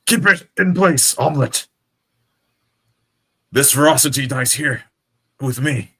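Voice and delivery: high, loud strained last stand; defiant shout. Acted. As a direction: shout through the pain. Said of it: "A, a weak, haggard shout comes out from under the rock".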